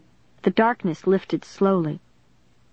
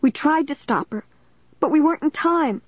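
high, distressed pleading voice; tearful; 9 semitones higher than usual. A narrator's reading. Caitlin explaining to the police officer, high and tearful. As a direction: protest tearfully, pitched up. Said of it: "wiping her tears off her cheeks".

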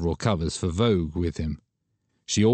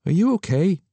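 higher, louder anxious question; worried; 11 semitones higher, 4 dB louder than usual. Acted. Friend worried about her, higher concerned question. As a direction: ask with real concern. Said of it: "He sounded worried then".

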